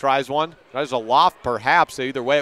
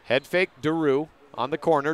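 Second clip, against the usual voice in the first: normal talking voice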